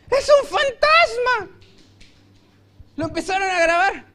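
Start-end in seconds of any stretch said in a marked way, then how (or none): 0.1-1.6 high, slow, frightened cry of ghost; terrified shout. Acted. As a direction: scream it in terror like sailors seeing a ghost. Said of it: "gritaron de miedo". (grito aterrado, agudo y lento)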